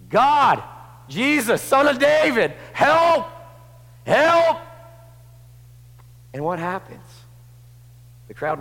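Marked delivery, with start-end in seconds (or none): 0.1-4.6 high, loud crying out for help; desperate cry. Acted. Blind men crying to Jesus past the crowd, shouting.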